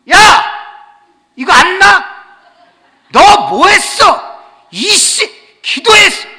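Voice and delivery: frozen husband raging. Retold or imitated. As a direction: rage at her, stuck and helpless. Frozen husband rages at wife.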